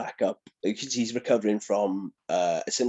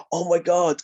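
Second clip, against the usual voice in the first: higher, louder mock amazement; excited fan reaction; 7 semitones higher, 5 dB louder than usual. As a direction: gasp it like a thrilled fan. Fans react on seeing him.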